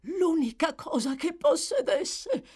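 vehement. Acted. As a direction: spit it out fiercely. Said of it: "rispose l'altra con veemenza".

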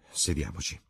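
ordinary delivery, nothing marked

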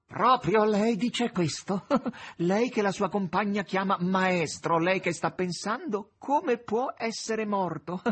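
slow, loud, high incredulous questions; mocking disbelief. A narrator's reading. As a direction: rub in the absurdity, drawing it out loudly. Azazello mocks the master's idea.

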